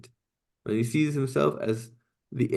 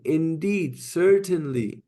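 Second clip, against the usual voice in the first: "Indeed" and "certainly" each pushed higher and slower, falling at the end; emphatic translation; 5 semitones higher, slower than usual. Shown as a demonstration. Teacher showing students the emphasis, stressing each word. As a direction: lean hard on "Indeed" and "certainly", slow and falling. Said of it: "We're saying".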